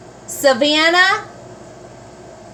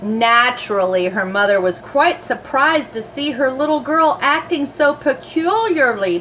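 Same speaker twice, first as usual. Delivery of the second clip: usual speaking voice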